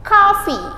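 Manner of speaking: coffee said as kafi, high and clear; model pronunciation. Shown as a demonstration. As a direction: say coffee as KAH-fee with a bright falling tune. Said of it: "Kita ubah huruf A di sini". (coffee diucapkan kafi, nada tinggi lalu turun)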